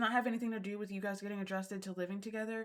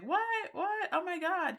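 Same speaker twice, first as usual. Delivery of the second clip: high squeaky What? What?, pitched way up; mock surprise; 9 semitones higher than usual. Recapping Mecca's reaction to her laughing, mock surprised and much higher. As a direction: play him caught off guard by her reaction.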